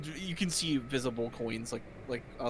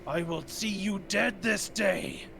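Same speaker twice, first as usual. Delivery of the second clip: booming djinn voice, slow and drawn out; genie; 4 semitones higher, much slower than usual. Acted. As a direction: bellow it like an angry djinn. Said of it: "The djinn's voice bellows".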